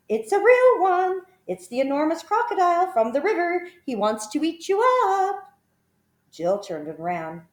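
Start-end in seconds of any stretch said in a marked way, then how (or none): 0.1-5.5 a little bird calling out in warning, high and loud; singsong bird voice. A narrator's reading. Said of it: "sang the roly-poly bird".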